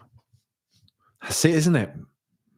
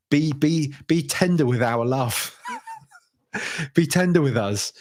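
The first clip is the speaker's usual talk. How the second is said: laughing plea; 6 semitones higher than usual. Real, not acted. Laughing while pleading with players.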